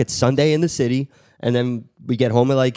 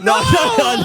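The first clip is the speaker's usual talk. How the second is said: high shout of protest; 5 dB louder, slower than usual. Real, not acted. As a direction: yell it out to stop them. Host shouting to stop.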